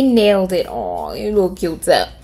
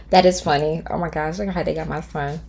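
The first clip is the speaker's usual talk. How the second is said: ordinary talk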